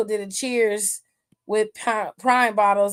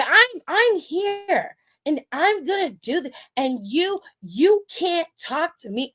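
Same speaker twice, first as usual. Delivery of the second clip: higher, whiny imitation of her acting; mocking a wrestler's weepy promo, higher and whiny; mock crying wrestler; 6 semitones higher than usual. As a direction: parody her teary promo voice, lightly. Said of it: "like she about to start crying".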